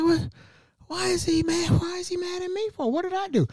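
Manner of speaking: comic bewildered whine, voice way up; acted-out worried pleading; panicked protest. Panicking that big man is mad.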